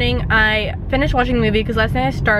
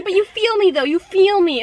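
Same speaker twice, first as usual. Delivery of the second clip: imitating the classmate; 8 semitones higher than usual. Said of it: "And she goes".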